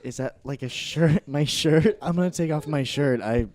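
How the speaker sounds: loud, eager and flustered; flustered excitement. Acted. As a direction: blurt it out, overexcited. Misunderstands, flustered.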